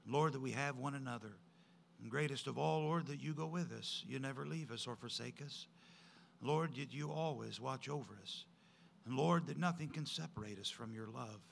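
soft, earnest closing prayer. Acted. Pastor praying over the congregation, soft and earnest. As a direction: pray it gently, head bowed.

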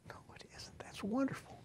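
hushed whisper. Acted. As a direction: breathe it like a secret. Sudden hushed rebuttal.

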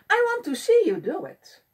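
can't wait to watch; excited. A narrator's reading. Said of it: "the pollywog said excitedly".

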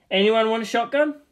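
ordinary talk

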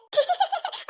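high teasing giggle; cheeky giggle. Acted. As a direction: giggle at her sister's trouble. Teasing laughter. (കളിയാക്കി ചിരിക്കുന്നു)

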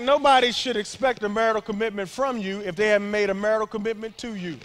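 loud sermon voice; full-force preaching. Real, not acted. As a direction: preach it hard.

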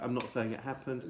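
ordinary talk

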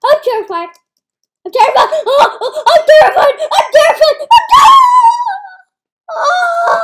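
hammed-up fright between laughs; laughing mock terror. Real, not acted. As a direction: squeal through laughter, building up.

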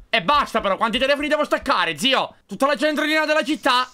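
high loud fed-up cry; exasperated outburst. Real, not acted. Gamer fed up with endless phones, exasperated outburst. Too many phones to hang up.

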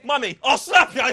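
loud, high Cockney threat through laughter; cracking up mid Cockney impression; Cockney threat, laughing. Mimicking a Cockney hard man, laughing and loud. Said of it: "He's got that old Cockney accent".

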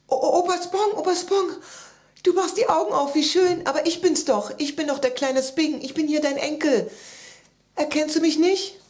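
high, loud, stuttering start; overexcited child. A narrator's reading. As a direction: gush urgently to be recognized. Child excitedly greets waking grandpa. (aufgeregt und laut drauflosreden)

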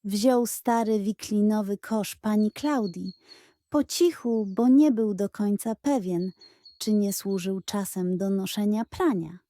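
ordinary delivery, nothing marked

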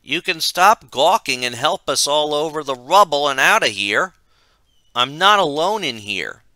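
loud, high, insistent call; shouted. A narrator's reading. Michael shouts at gawking friends to help them out, loud shout. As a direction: shout for help across the room. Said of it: "watched as Michael shouted".

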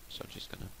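hushed whisper to the viewers; soft conspiratorial whisper. Real, not acted. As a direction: whisper it as if not to be heard. Whispering while waiting on skeleton.

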